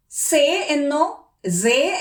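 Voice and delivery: സേ said slowly as a plain se, the wrong way; wrong version. Shown as a demonstration. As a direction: show the wrong സേ. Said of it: "പക്ഷെ ജർമ്മനില് ... അല്ല". (തെറ്റായ ഉച്ചാരണം)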